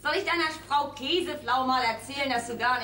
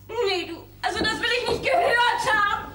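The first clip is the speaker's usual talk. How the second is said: high, louder indignant cry; indignant outburst; 9 semitones higher, 5 dB louder than usual. Acted. Olga flares up at Fred, higher and louder. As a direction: refuse to hear it, voice rising.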